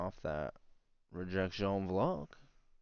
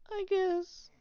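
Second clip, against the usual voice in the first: very high, squeaky and louder; 7 dB louder than usual. Pretend. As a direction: squeak it out in falsetto. Sudden squeaky echo of the line.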